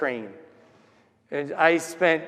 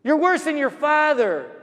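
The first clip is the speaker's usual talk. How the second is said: high, raised voice of a berating mother; imitated angry shouting; 8 semitones higher, 4 dB louder than usual. Woman swearing as she walks, imitated shouting. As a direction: scold harshly, pitched well up. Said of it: "and she's shouting".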